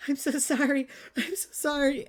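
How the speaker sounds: high, loud, gasping sorry; gasping apology. Acted. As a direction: blurt it out breathless and desperate. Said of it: "you just hear her gasp as she just goes".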